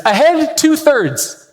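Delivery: high, slow drawn-out order; captain's command. Retold or imitated. Captain giving an order to the crew, slow called-out command. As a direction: call it out like a captain on deck. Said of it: "when the captain yells out an order".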